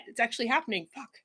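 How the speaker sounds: ordinary delivery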